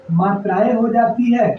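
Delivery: usual speaking voice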